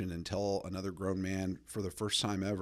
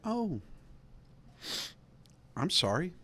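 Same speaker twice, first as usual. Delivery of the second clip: mock sympathy; 8 semitones higher than usual. Mock sympathetic reply to her.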